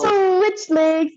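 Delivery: loud, high, drawn-out instructor call. Real, not acted. Instructor cues the class mid-chat, calling out. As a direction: call the switch out to the whole class. Calls exercise change to class.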